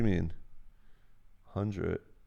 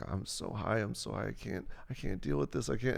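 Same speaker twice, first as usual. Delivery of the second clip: panicked, softer murmur; scared and softer; 8 dB quieter than usual. Retold or imitated. Girlfriend overwhelmed in the theater, scared and softer. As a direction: mimic her freaking out, hushed.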